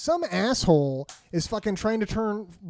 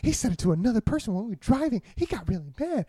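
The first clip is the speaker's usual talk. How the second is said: put-on tattling kid; 3 semitones higher than usual. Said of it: "She's like".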